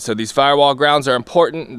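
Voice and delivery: normal talking voice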